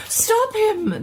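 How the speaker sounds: high, loud desperate cry; the doctor cries out to save his charger; shout. Acted. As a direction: yell to stop him at once. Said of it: "the doctor shouted".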